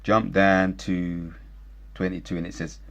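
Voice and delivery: usual speaking voice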